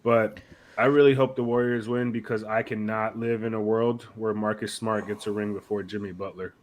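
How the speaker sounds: normal talking voice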